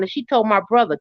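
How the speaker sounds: ordinary delivery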